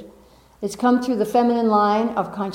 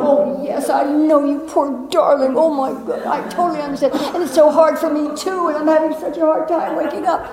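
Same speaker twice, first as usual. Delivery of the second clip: everybody joining the ego's complaint, hammed-up pity; mock sympathizers; 4 semitones higher than usual. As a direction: coo like a crowd of fellow sufferers. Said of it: "And everybody goes".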